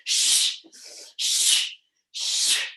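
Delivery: a string of hushing shh sounds; rhythmic librarian shushes; repeated shushes. Shown as a demonstration. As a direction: keep shushing the energy down. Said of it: "make a sound like you're being told off by a librarian".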